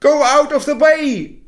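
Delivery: put-on high, loud child shouting. Boy yelling at the stars to move, high and loud. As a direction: clear the road with a yell. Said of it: "shouted the little boy".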